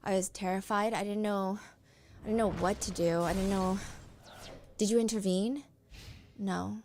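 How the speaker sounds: normal talking voice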